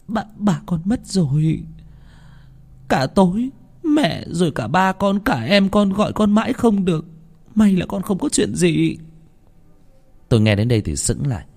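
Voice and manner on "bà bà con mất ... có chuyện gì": high, slow, choked sobbing call; a mother breaking down on the phone. A narrator's reading. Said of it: "âm thanh của mẹ nức nở". (giọng mẹ nức nở, nghẹn ngào)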